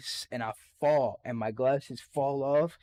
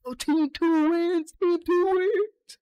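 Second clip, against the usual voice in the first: much higher, slow, louder announcer voice; mock gym teacher; 5 dB louder, slower than usual. Gym teacher announcing the winning team in a high drawn-out voice. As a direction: ham up the teacher calling the result.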